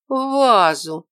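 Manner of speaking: loud, high, astonished echo; gasped surprise. A narrator's reading. Orderly reacts to the visitor's further request, gasping. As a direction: exclaim it as if taken aback. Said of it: "ахнула она".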